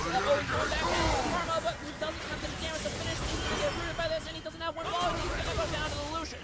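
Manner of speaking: rising, hyped-up casting; excited play-by-play. Real, not acted. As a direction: call the fight as it explodes.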